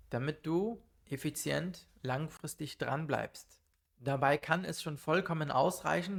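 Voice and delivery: ordinary talk, nothing marked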